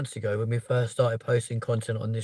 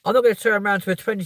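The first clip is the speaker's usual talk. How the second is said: ordinary talk, nothing marked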